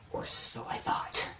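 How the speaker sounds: whispered ominous twist; dramatic whisper. Real, not acted. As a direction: drop to a breath for the reveal.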